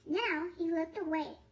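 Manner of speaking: ordinary delivery, nothing marked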